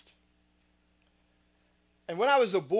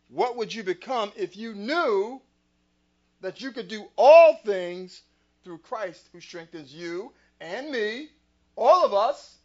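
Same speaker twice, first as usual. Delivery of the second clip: building preach; 10 dB louder than usual. Real, not acted.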